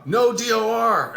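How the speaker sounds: high, loud, drawn-out chant; retold group yell. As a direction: yell it slowly, imitating the class. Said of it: "start yelling".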